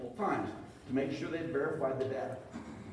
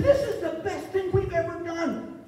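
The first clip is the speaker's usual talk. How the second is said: much higher, gushing delight; imitated elation; 13 semitones higher, 5 dB louder than usual. Scientist bragging about the study, much higher and delighted. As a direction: gush like it's the best day ever.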